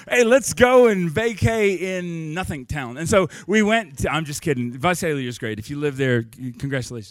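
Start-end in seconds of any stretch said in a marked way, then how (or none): 0.1-3.0 higher, louder, slow hammed-up invitation; mock cheerful pitch. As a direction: sell the dull town with fake enthusiasm. Mocking a vacation pitch.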